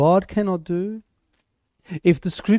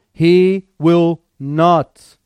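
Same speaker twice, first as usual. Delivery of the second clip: He will not, very slow, "He" stretched; emphatic, drawn out; 3 semitones lower, 5 dB louder, much slower than usual. Shown as a demonstration. Preacher driving home the promise, slow emphatic. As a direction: stretch each word out. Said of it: "This is emphatic".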